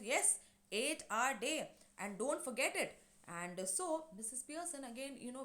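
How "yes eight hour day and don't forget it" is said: slow, firm weight on eight-hour day; stressed insistence. Retold or imitated. Son insisting to his mother about working hours, slow and stressed. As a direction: lean hard on eight-hour day, slowly. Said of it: "he also stresses and says".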